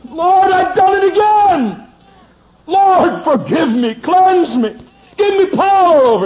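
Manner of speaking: high, loud anguished plea to the Lord; wailing prayer. Retold or imitated. A wife crying out to the Lord after her TV habit, high and loud. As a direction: cry out to God in despair, loud and high. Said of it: "you've said".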